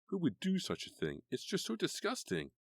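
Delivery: high, outraged disbelief; rising disgust at the prank; upset and disgusted. A narrator's reading.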